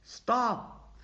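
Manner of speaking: high, sharp stop; sharp shout. A narrator's reading. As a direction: yell at the horses. Driver halting runaway horses.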